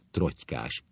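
ordinary delivery

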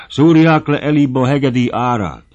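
high, loud angry demand; emboldened demand. A narrator's reading. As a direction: shout for payment, defiantly. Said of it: "a többiek is neki bátorodának".

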